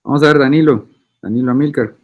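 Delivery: ordinary delivery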